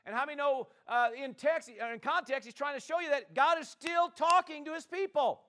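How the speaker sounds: fiery preaching climax; preaching shout. Acted. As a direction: shout it out to the church.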